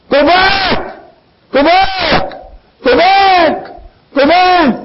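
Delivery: high, ringing repeated command; insistent command. Retold or imitated. Preacher voicing Naomi sending Ruth away, high insistent calls.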